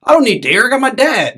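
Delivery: put-on high, loud kid's boast; imitated boasting son. Son telling mom his dad is enough, boastful.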